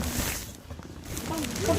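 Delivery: normal talking voice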